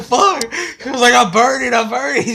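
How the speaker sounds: very high, loud laughing yell; hammed-up retold screaming; mock panicked shout. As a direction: imitate frantic cries while cracking up. Acts burning boy while laughing.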